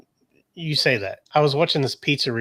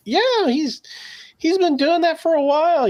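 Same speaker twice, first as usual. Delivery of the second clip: much higher put-on voice; imitated coworker; 14 semitones higher than usual. Coworker telling the boss about his reviews, high imitated voice. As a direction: pitch way up to mimic a coworker. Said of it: "this other coworker was like".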